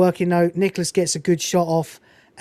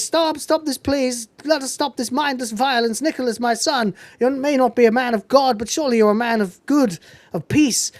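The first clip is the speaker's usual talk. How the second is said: higher, lightly acted preacher's plea; pleading reverend; 4 semitones higher than usual. Narrator voices Reverend Shooter appealing to Nicholas, higher mock plea. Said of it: "Reverend Shooter, sh-, uh, says".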